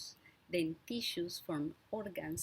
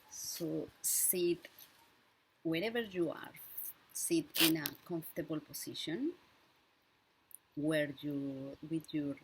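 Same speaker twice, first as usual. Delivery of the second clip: unhurried breathing cues, leaving silence for each breath; guided breathing meditation. Real, not acted. Leads a breathing exercise.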